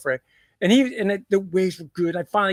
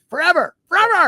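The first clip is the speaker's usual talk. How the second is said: rising higher and louder with each repeat; building insistence; 10 semitones higher, 6 dB louder than usual. Retold or imitated. Dad promising his son the wave of his life, building louder. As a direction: crescendo the word like a promise.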